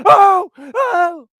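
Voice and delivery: high, loud acted wail; hammed-up grief cry; mock crying. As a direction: cry out over the dead brother. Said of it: "He's crying".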